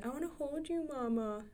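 a hint of a sweet toddler asking to be held; imitating her little son. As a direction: mom mimicking her son's request. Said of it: "he said".

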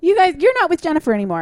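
high, quick prissy schoolgirl announcement; flouncing friends. Retold or imitated. Friends telling the boyfriend he's dumped, high and quick. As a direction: rattle it off high and sassy. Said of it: "flounce up to him and be like".

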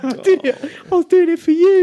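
very high, loud comic film voice; Full Monty impression. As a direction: put on a squeaky, pleading film-character voice. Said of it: "remember that film, The Full Monty".

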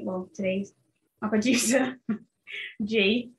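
ordinary talk, nothing marked

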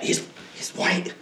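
conspiratorial hushed aside; whisper. Real, not acted. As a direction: lean in and whisper it. Aside about the cop.

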